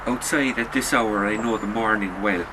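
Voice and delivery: ordinary delivery, nothing marked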